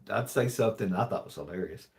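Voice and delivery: usual speaking voice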